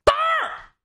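high, sudden sharp shout; shouted call. A narrator's reading. Sharp-eyed gambler calls the count at once, shouting. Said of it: "有个眼尖的马上喊了".

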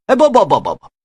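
put-on flustered old man cutting in; hasty denial. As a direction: brush it off in a fluster. Ziya hastily denies.

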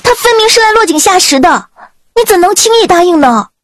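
high-pitched angry protest; indignant. A narrator's reading. Maid scolding her mistress for agreeing too easily, indignant. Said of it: "咬牙切齿道".

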